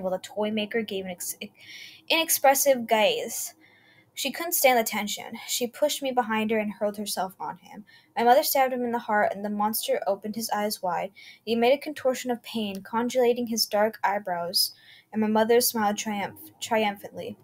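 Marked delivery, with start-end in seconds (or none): none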